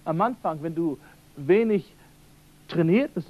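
normal talking voice

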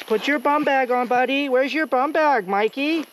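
put-on high sing-song nagging, as to a little kid; mock coddling voice. Teasing himself as if a child.

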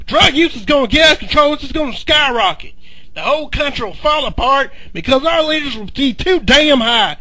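ordinary talk

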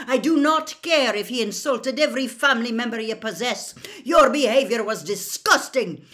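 loud, high, emphatic dressing-down; furious tirade. A narrator's reading. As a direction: lay down punishment in outrage. Furious teacher hands out punishment.

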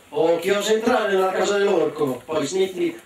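booming greedy ogre, slowing on the last words; ogre's roar. Acted. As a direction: play the hungry ogre roaring. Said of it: "si alzò in piedi e gridò".

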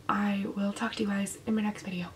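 quick soft whisper goodbye. Real, not acted. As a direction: breathe the sign-off out. Sign-off whispered.

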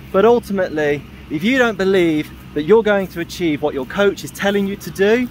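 ordinary talk, nothing marked

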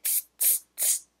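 soft unvoiced teasing tss; monkey tsk sound. Monkeys mocking the peddler, soft voiceless tss. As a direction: hiss back like cheeky monkeys. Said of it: "the monkeys only ... said".

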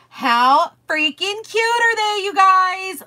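high, drawn-out squeal of delight; excited gushing. Real, not acted. Host shows a cute new design to viewers, gushing.

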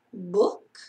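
a wise owl peering down; owl's curious voice. Acted. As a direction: Owl asking from hiding. Owl's voice from nowhere.

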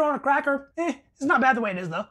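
talking with food in mouth; chewing. Real, not acted. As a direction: mouth partly full. Seen 2: Bites the bread at -1.0, then talks with mouth working, holding the bite.

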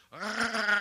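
a short strangled argh; heart noise. Retold or imitated. Doctor imitating the fibrillating heart, short strangled argh. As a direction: make the heart's shudder. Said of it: "the top chamber of your heart's going".

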